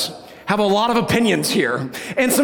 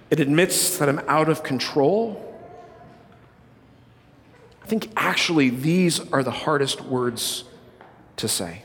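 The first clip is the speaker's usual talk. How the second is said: solemn quiet; 6 semitones lower, 4 dB quieter than usual. Acted. Sermon turns solemn.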